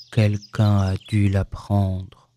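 low, slow, gloomy donkey; sombre. A narrator's reading. Donkey learning his tail is gone, sombre. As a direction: say it with heavy gloom, deep and drawn out. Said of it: "d'un air sombre".

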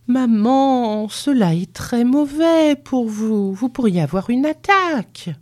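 high, anxious, slowing warning; trembling. A narrator's reading. Timid son warns mother of a stroke, trembling. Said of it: "en tremblant pour dire".

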